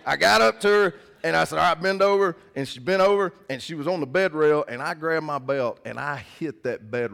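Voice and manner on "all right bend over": louder, higher stern dad command; stern order. Retold or imitated. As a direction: bark it like a strict father. Dad orders daughter before spanking.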